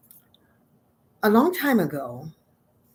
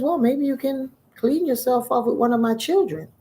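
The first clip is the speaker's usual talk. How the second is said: lightly voiced helpful tree, higher pitch; gourd tree; 6 semitones higher than usual. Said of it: "He says".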